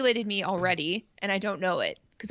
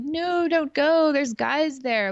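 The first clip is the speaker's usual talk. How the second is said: high, slow, louder begging; pleading whine; 7 semitones higher, 5 dB louder, slower than usual. Retold or imitated. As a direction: drag it out like a clingy plea. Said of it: "And he's like".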